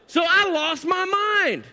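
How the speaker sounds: high, drawn-out comic outburst; losing it. Retold or imitated. Dad confessing his trail meltdown, worked up and high.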